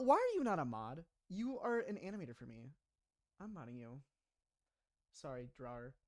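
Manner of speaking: usual speaking voice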